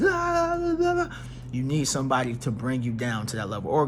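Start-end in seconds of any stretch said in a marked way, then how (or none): 0.0-1.1 mock rambling Leo.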